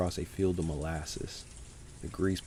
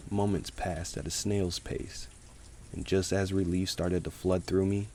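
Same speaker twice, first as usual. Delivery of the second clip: usual speaking voice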